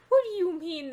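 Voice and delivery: high, loud crone demanding answers; crazed old woman. Acted. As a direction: burst out high, then let it fall. Old woman reacts to frogs.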